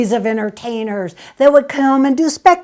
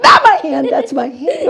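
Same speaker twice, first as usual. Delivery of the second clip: mock protest; 7 semitones higher, 5 dB louder than usual.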